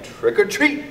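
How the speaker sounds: put-on high, bright kid's call of trick or treat; trick-or-treater. A narrator's reading. Child at the door calling trick or treat.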